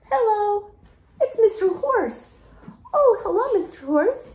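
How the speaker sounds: high-pitched, playful hello to a pretend animal; high children's-show greeting; excited greeting.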